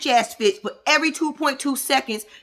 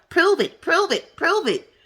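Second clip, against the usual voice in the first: mimicking Mel; 7 semitones higher, slower than usual. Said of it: "her favorite line".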